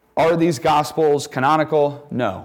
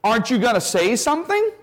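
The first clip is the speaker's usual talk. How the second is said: incredulous Pilate; 11 semitones higher than usual. Pretend. Pilate questioning Jesus.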